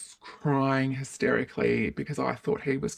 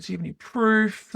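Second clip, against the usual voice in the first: raised, doubting question; skeptical cop; 7 semitones higher, 4 dB louder than usual. Retold or imitated. Police asking the woman for proof, higher and doubtful. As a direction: sound unconvinced.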